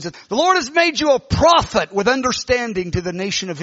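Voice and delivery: authoritative prophecy. Retold or imitated. Woman prophesying to Bob Jones, authoritative declaration. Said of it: "she had a volume and an authority".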